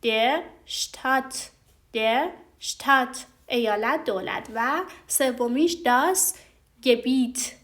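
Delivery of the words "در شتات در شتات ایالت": شتات slow, moderately long aa; slightly long aa in شتات; right Staat. Shown as a demonstration. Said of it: "باید بگید".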